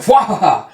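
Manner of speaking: hammed-up triumphant cackle; villain laugh. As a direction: exult with a theatrical wahaha. Villain exults over the girl.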